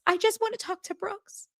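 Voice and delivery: high, far-off whiny imitation; mock her pining whine; whiny mimic. Podcaster mimicking Meredith pining for her kids, high whine. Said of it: "All she cares about is Brooks".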